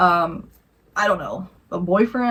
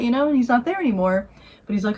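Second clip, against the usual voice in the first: plain reading voice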